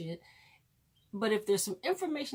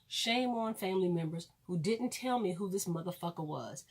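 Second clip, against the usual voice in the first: usual speaking voice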